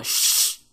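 long bare "sh" hiss. Shown as a demonstration. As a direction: sustain a voiceless "sh". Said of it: "La letra X se pronuncia como".

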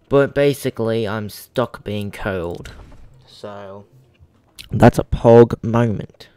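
a streamer's mock British accent, lightly done; playful fake British accent for the chat; put-on British accent. Gamer greeting his stream chat in a mock British accent. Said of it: "stop talking like a British ac-, in a British accent".

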